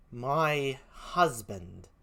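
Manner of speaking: very slow, low and quiet; stunned echo. Acted. Emilia repeats the news to herself, stunned. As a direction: let the words out softly in shock.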